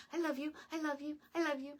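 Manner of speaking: high, fast, eager puppy gushing; adoring dog voice. Retold or imitated. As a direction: gush it like a dog that loves its owner no matter what. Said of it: "and they'd be like".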